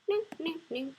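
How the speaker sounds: ordinary delivery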